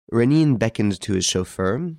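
ordinary delivery, nothing marked

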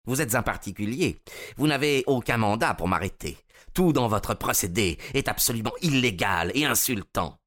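offended prisoner asserting his rights; Von Bork, indignant. Acted. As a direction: protest the outrage point by point.

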